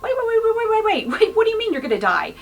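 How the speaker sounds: high, rushed panicked stammer of wait; sudden alarm, pitch jumping up; panicking. Retold or imitated. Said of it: "He was panicking".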